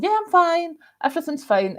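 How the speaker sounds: high-pitched imitation of being fine; sing-out, high self-quote; mock breezy reassurance. Retelling false calm before the gust.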